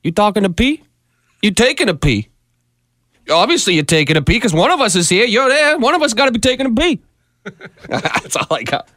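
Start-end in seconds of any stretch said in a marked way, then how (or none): 0.0-7.0 a hint of De Niro, mock tough; lightly voiced De Niro, toilet joke. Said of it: "your De Niro on the toilet impression".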